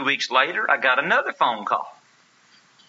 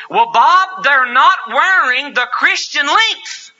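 loud, high, earnest elder; hammed-up insistence, loud and high; insistent elder; 13 semitones higher, 7 dB louder, slower than usual. Elder insisting on dress rule.

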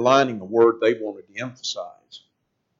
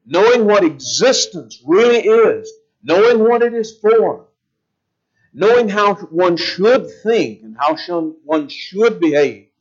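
building fervor; 6 semitones higher, 4 dB louder than usual. Real, not acted. Preacher driving the point home to the congregation, fervent. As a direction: build the intensity phrase by phrase.

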